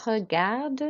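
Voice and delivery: "regarde" with French R and sounded D; French "regarde", clear D at the end; rolled R. Shown as a demonstration. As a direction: roll the R in "regarde". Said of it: "roll your R".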